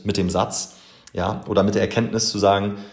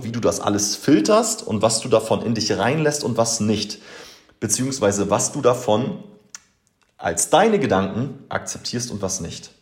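ordinary talk, nothing marked

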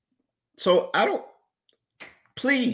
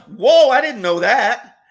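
mock amazement, higher, louder and slow; sarcastic; 10 semitones higher, 11 dB louder, slower than usual. Host mocking cohost with sarcastic fake surprise. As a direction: fake wide-eyed shock, drawn out. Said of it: "I detect some sarcasm there".

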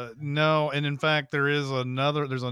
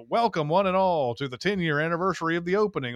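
higher, grand fast announcer patter; old-timey announcer; 5 semitones higher, faster than usual. Shown as a demonstration. Announcer opening a ceremony for a crowd, old-timey announcer voice. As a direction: announce it like an old-time showman. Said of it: "announcer voice".

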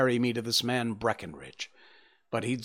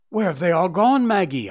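loud, higher frantic cry; 6 semitones higher, 7 dB louder than usual. A narrator's reading. Thief crying out to his sister in the empty yard, frantic. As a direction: call out in sudden alarm. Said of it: "I cried".

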